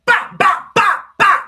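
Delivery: loud, high "bah" blows mimicking punches; cartoon punch noises, high and loud; punch sound effects. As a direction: belt out each punch as a sharp high bah. Imitating punches landing.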